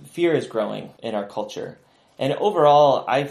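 normal talking voice

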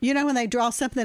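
ordinary delivery

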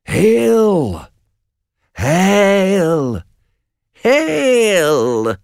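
high, eerie drawn-out hail; three witches calling out of the fog; uncanny high witch cry, each hail held long. Acted. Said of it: "three women call out to them".

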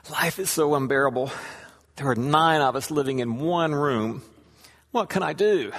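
plaintive despair. Acted. Congregant pleads with his rabbi about crowded home, plaintive. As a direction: play a man at wits' end, mildly overdone. Acted complaint of man to rabbi.

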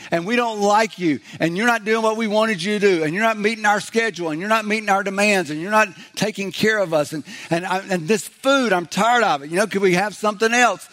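high-pitched mock tirade of grievances; griping, complaining. Preacher voicing Israelites rising up against God, griping. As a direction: pile up the complaints in an exasperated put-on voice. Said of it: "risen up against God and go".

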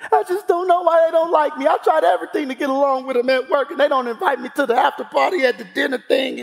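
put-on high, whining child voice; mock sulky kid complaining about coworkers; whiny little boy. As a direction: pout it out like a hurt twelve-year-old. Acting out his inner little boy.

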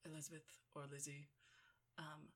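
soft, low, grave father voice; hushed, measured film-quote delivery; Donald Sutherland. Acted. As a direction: quietly pronounce a solemn ultimatum. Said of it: "Donald Sutherland says".

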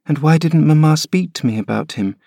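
put-on high, plaintive child voice, pitch rising; a frail boy pleading to stay; sickly boy. Linton protesting to his nurse, high whining boy. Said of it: "persevered the child".

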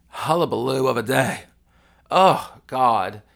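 loud exasperated laugh. Real, not acted. Laughing exhale after the story.

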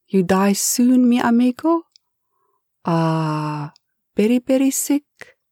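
lightly voiced Spanish-accented Eustacio; a hint of a nervous Spanish-accented servant, pleading. Eustacio asks if boss is dying.

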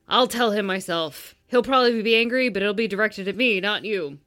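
loud, forceful book hero; man's character voice. Acted. Host reads the stern hero's lines, commanding. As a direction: lay down the law, firmly and loudly. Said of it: "Yeah, do the voices".

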